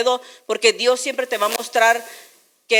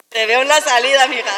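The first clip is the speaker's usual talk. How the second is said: mock tough threat; 3 semitones higher, 4 dB louder than usual. Mock threat to praying woman.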